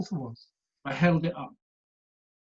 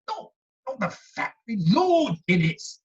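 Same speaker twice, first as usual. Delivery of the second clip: put-on old man correcting; 7 semitones higher, 3 dB louder than usual. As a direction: set her straight, proudly.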